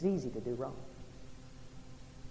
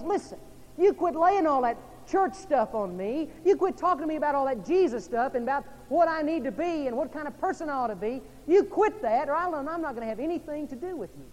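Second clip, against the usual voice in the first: raised, higher-pitched quarrelling voice; acted ultimatum; 7 semitones higher, 6 dB louder than usual. Preacher voices an unbelieving partner warning the believer, heated ultimatum. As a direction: lay down an ultimatum, acting out the quoted partner.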